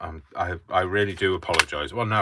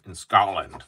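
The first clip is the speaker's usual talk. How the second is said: put-on louder, failed stab at a Scottish accent; bad Scottish accent; 2 semitones higher, 7 dB louder than usual. Crafter echoing his helper, unconvincing Scottish accent. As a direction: attempt Scottish and miss. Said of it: "That's not a Scottish accent".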